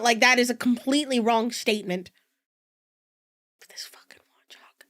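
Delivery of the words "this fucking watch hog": a soft, fast, hissed threat; mock-sinister whispered vow; menacing whisper. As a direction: whisper a grudge like a villain. Vowing revenge on Lenora.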